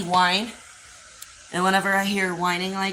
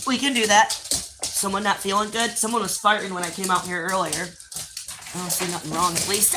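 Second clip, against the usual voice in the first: ordinary talk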